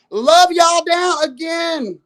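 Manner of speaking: sarcastic shout. Real, not acted. Sarcastic sign-off to rivals.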